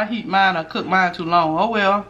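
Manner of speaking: normal talking voice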